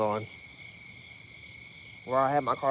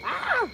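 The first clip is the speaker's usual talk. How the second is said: startled yell. Real, not acted. Fish jumps, startled yell.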